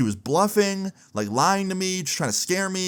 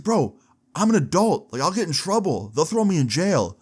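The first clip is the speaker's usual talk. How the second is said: higher, mock-anxious pleading; imitating panicked friend; 6 semitones higher than usual. Friend insisting he can't go to jail, imitated anxious plea. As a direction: retell his fear, pitch up.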